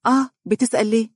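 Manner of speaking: high, quick wife's voice; questioning. A narrator's reading. Wife asking her husband why, high and curious. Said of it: "وقالت متسائلة".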